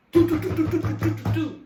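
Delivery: loud, rapid do-do-do mimicking pounding on a door; knocking imitation. Boss pounding on the bathroom door, loud mock knocking. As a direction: bang out the knocks with your voice like the police.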